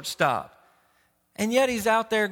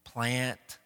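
lightly acted sheepish self-rebuke, lower and softer; Jonah backing down; 7 semitones lower, 5 dB quieter than usual. Preacher imagining Jonah admitting his foolishness, sheepish and subdued. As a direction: wave it off as your own silliness. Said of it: "Jonah's response isn't".

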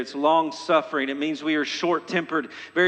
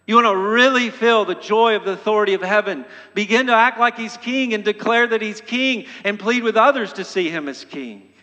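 louder, higher, fast urging exhortation; preaching at full force; 5 semitones higher, 5 dB louder than usual. Acted. Preacher urging the congregation to declare him king, fervent. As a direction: drive the call home to the pews.

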